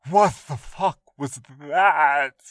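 loud, slow, pained cry; pained outburst. Acted. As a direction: blurt it out in shock and pain. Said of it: "Gamble's voice wasn't jokey anymore".

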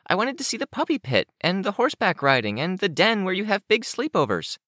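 put-on very high, childlike character voice; eager young Kenzie. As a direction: pitch it way up and pour out the longing.

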